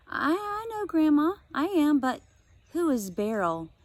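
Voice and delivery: put-on high, stammering young girl; nervous girl. Josie nervously asks Grandma who Beryl is. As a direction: stumble over the words, unsure.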